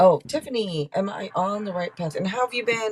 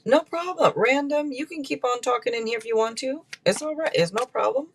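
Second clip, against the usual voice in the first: much higher, bright "no problem" to a viewer; high reassuring voice; 9 semitones higher than usual. Real, not acted. Tarot reader reassures chat member she can keep talking, lifted friendly tone. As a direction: soothe them, it's fine, sung up high.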